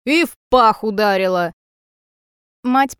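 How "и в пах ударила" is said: loud, aggrieved outburst; wailing complaint. A narrator's reading. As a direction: complain loudly of the injury. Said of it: "вопит мужик".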